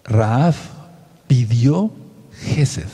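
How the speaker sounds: Rahab, pidió, Gesed, each word said separately with pauses between; slow word-by-word repeat. Shown as a demonstration. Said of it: "Voy a volver a repetir".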